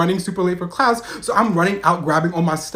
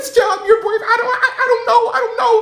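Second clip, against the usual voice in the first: high, loud, flustered stammering; panicked stammer; 4 dB louder than usual. Retold or imitated. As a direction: stammer excuses while under attack. Caught, stammering answer to screaming sister.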